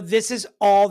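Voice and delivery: normal talking voice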